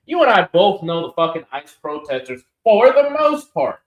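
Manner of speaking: ordinary delivery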